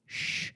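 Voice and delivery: one drawn hushing hiss; imitated shushing. Host voices captors silencing the governor, imitated shush. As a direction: shush someone who keeps talking.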